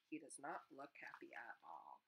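ordinary delivery

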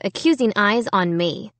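ordinary delivery

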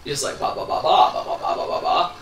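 unvoiced ba ba babble of a flapping mouth; cartoon mouth babble. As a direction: mimic a jerky cartoon mouth. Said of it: "he looks like a Jib Jab cartoon".

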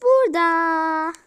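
slow, loud announcement; dramatic arrival. Acted. Character slowly announces their grand arrival. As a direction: draw it out grandly.

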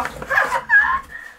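very high, delighted giggle; squealing happy laugh; giddy laugh. Real, not acted. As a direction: laugh out with surprised glee. Delighted by surprise prize.

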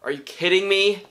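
high, loud, incredulous outburst; mad. Real, not acted. Viewer who wanted the character in season two, indignant. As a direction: throw it out as an indignant question. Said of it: "I'm so mad".

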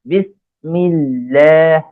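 বিসমিল্লাহ very slowly, syllable by syllable; pronunciation demo. Shown as a demonstration. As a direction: draw out the doubled l and long "laah" in বিসমিল্লাহ. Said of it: "এইভাবে উচ্চারণ করার চেষ্টা".

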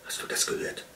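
hushed, breath-only question; secretive, agitated whisper; excited whisper. A narrator's reading. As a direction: whisper it urgently to a companion. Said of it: "flüsterte der Fuchs auf-, aufgeregt".

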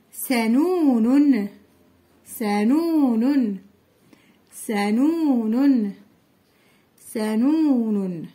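سنون drawn out slowly, each syllable clear; slow choral reading. Shown as a demonstration. Teacher leading children reading سنون aloud slowly. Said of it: "إذا نقرأ".

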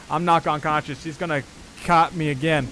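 normal talking voice

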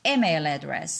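"email" punched, "address" falling away; first-word stress. Shown as a demonstration. Teacher giving a two-word example, stress on "email". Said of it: "Stress in two word pairs".

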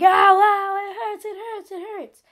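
high, wailing ow ow, half sung; retold crying wail. A kid with a cut thumb wails to the class, high and crying. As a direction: whimper it like a hurt little kid. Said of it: "And I was screaming, and I was crying".

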